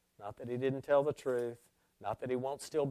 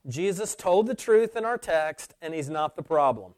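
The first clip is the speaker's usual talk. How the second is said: loud, raised pulpit declaration; emphatic preacher's voice; forceful preaching; 4 semitones higher, 9 dB louder than usual. Real, not acted. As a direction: state it to the congregation with full conviction.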